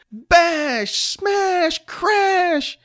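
put-on very high child battle noises; excited play crashing. Johnny runs over army men, excited play shouts. Boy plays crashing toy tank.

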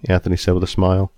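ordinary delivery

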